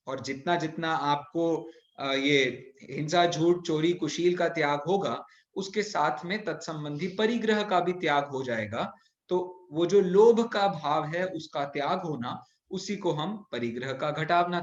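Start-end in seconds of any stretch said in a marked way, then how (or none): none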